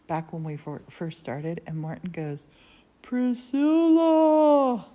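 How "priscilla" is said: loud, high call stretched very long; drawn-out calling. Retold or imitated. Morton calling to her from up above, long loud holler. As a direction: holler the name as if to someone floors away.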